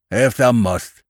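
grudging farmer's consent; ungracious. A narrator's reading. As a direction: give way with bad grace. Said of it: "said the man ungraciously".

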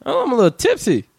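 put-on tipsy girl voice, quick and soft; giggly drunk. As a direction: play her a little tipsy. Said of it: "when she go".

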